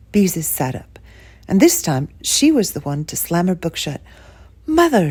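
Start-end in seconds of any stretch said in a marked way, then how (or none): 4.7-5.1 high, louder eager cry; excited cry. A narrator's reading. Daughter hearing of the new bedroom, crying out excitedly. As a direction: burst out with delight. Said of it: "she cried".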